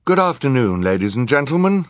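high, loud formal greeting; judge, formal. A narrator's reading. Judge greeting the courtroom, formal. Voices the judge opening the trial.

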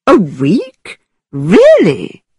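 overdone theatrical amazement; mock-astonished gentleman; excessive surprise. A narrator's reading. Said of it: "with excessive surprise".

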